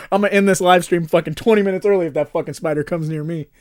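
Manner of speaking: loud, fast panicked laughing rant; screaming at a spider. Real, not acted. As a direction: freak out about the spider, laughing.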